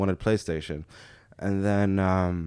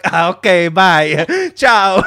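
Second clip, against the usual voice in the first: mock Italian farewell; 9 semitones higher, 12 dB louder, slower than usual. Scammer giving up, Italian goodbye.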